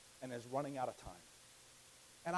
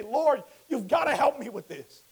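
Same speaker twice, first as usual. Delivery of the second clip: pleading prayer; 5 semitones higher, 8 dB louder than usual. Acted. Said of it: "And you say".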